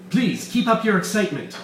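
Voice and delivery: high, mocking villain voice; gleeful villain. Weiss taunting Bull about his excitement, high and mocking. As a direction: tease them with glee.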